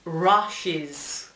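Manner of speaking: "Rushes" with the U said as "ah"; U como A. Shown as a demonstration. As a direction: demonstrate "Rushes" as RAH-shiz. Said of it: "como una A". («Rushes» con la U pronunciada como A)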